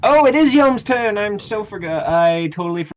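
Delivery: loud, high burst of surprise; sudden realisation. Real, not acted. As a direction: blurt it out high, easing down as it goes. Game master suddenly realises missed turn.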